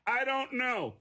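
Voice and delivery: high-pitched, helpless non-answer; dumb response. Retold or imitated. Suspect answering the cop's question, clueless. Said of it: "the second dumbest response I've ever heard".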